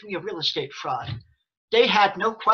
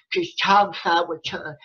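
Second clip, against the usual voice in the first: normal talking voice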